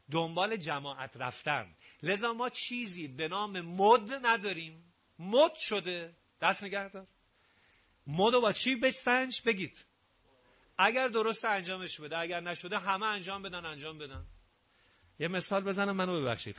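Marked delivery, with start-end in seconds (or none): none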